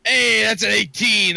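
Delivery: thrilled outburst at the table; excited cheer. Real, not acted. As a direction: shout out a lucky dice roll. Lucky dice roll celebrated.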